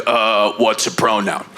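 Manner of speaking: low, slow, baffled dad voice; lightly voiced clueless father drawling it out; a hint of a bewildered Brooklyn father; confused old dad. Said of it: "He's like".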